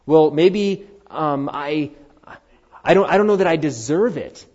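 preacher voicing someone refusing grace; mock objector. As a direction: play the doubter who won't accept it.

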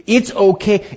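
usual speaking voice

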